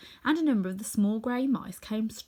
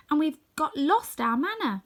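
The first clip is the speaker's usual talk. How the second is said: Dorothy; 7 semitones higher, 5 dB louder than usual. Acted. Dorothy answers the king.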